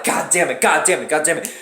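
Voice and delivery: low, soft frustrated mutter; muttering god damn it; muttered cursing. Retold or imitated. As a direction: curse under breath. Frustrated as car struggles.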